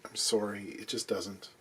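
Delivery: normal talking voice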